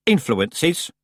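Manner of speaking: icy voice. A narrator's reading. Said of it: "a voice so icy".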